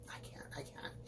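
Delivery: a hushed, playful "I can't"; softly whispered mock panic; mock whispered refusal. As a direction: whisper the refusal, backing away.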